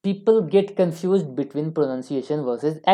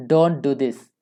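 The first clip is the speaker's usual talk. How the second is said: normal talking voice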